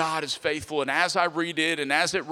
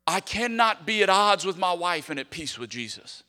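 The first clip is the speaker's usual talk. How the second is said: usual speaking voice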